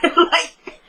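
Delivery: laughing through the word; real laughter. Real, not acted. Laughing at the absurd hearing while recapping for viewers. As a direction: breaks up laughing mid-sentence.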